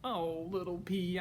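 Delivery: plain reading voice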